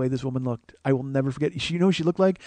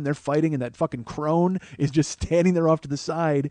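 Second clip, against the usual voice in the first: ordinary talk, nothing marked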